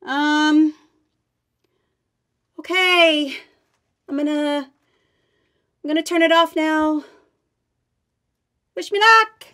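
high, loud, huffy calls from afar; from another room. Acted. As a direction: call up from the basement, offended. Calling from the basement.

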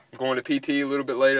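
ordinary delivery

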